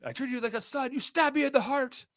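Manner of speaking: high-pitched mock outrage; hammed-up betrayal; betrayed movie character. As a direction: play the wounded film boss, voice way up. Same movie quote acted out again.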